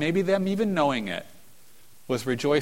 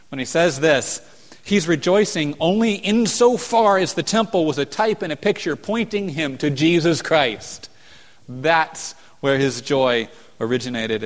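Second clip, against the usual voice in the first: raised, louder preaching voice; fervent preaching; 4 semitones higher, 6 dB louder than usual. Acted. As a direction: drive the point home from the pulpit. Preaching at rising force.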